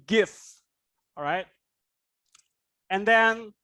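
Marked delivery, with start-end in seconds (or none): 0.1-0.3 "give" finished with an f-like fricative; correct GIVE. Shown as a demonstration. Teacher giving the model again, "give" with its ending sounded. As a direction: let the end of "give" be heard.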